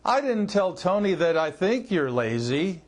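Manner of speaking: "think" leaned on, then "you're lazy" dips and rises; stress on "think". Shown as a demonstration. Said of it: "How about this?".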